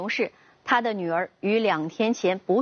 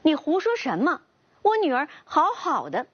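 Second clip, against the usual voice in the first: higher-pitched indignant retort; irritated, disbelieving pushback; annoyed questioning; 6 semitones higher than usual. A narrator's reading. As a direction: challenge them with mild narrated anger. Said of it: "恼怒地质问朋友道".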